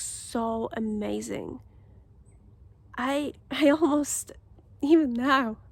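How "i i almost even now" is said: laughing through a choked-up voice, on the edge of crying; fighting back tears, louder; close to tears. Real, not acted. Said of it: "I'm almost start crying".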